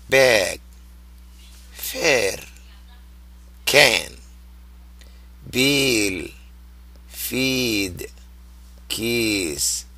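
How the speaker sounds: long vowels stretched slowly, one word at a time; drawn out vowels. Shown as a demonstration. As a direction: hold each vowel long and clear. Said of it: "So see how stretched the sounds are".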